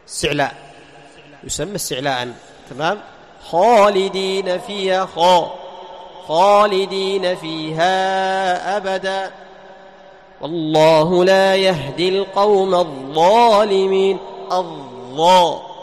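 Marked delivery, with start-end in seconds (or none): 3.5-9.3 slow chanted recitation with a full, heavy خالدين; tajweed demo of a heavy kha; heavy Quranic kha. Shown as a demonstration. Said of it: "صعد الصوت إلى الحنك الأعلى". 10.4-14.2 slow recitation, الظالمين said with a full, heavy ظ; tajweed demo of tafkhim; heavy emphatic letter. Shown as a demonstration. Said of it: "صعد الصوت إلى الحنك الأعلى".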